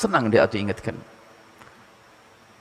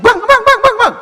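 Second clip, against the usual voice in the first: very high, loud calling out to her husband; shouting wife; 7 dB louder than usual. Acted. Wife shouts a warning at her husband. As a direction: yell a frantic warning, laughing. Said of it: "Oh, teriak lagi".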